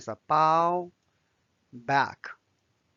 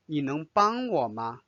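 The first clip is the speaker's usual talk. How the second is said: normal talking voice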